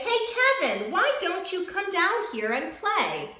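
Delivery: high calling voice up to a tree; shouted call. A narrator's reading. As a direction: call up to Kevin to come play. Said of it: "shouted one day".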